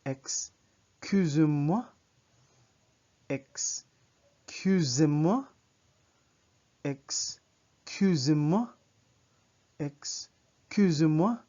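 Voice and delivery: very slow, loud, drawn-out teaching demo. Shown as a demonstration. Teacher slows the French phrase for learners, very slow and loud. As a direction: stretch each syllable out, projecting, with a rising end. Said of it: "I'm gonna pronounce it slower".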